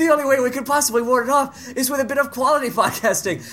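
high, urgent hammed-up pitch; melodramatic hero. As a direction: proclaim the one way to save everyone. Melodramatic promo pitch in skit.

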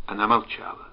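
ordinary delivery

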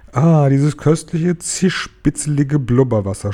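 dreamy rave over a delicacy; rapturous gushing. A narrator's reading. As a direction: savour every word with delight. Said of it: "schwärmte er".

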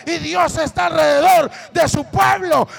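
preaching at full force. Acted. Preacher proclaims Bible verses to the congregation, shouting.

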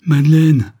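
loud, high anguished call of her name; heartrending cry. A narrator's reading. The count cries out as Madeleine walks away, heartbroken. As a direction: cry out as she leaves you. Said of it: "s'écria d'un ton déchirant".

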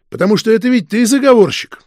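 plain reading voice

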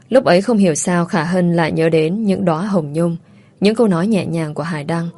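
plain reading voice

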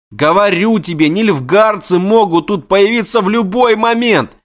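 high, fast, panicky chatter; jittery poet begging his friend; frantic poet. Acted. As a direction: babble in a panic to flee. Voiced poet character.

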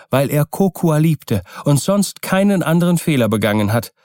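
put-on impassioned young wife Kokua. Kokua vows to save her husband, impassioned.

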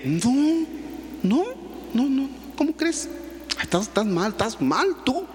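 high, protesting husband; indignant denial. Retold or imitated. Cheating husband in the story brushing off his wife, high and indignant. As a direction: deny it all, voice up high. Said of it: "Y le dice".

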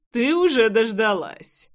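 slow, cocky, self-assured; smug. Acted. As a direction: drawl it with a smirk. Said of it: "самоуверенно хмыкнул парень".

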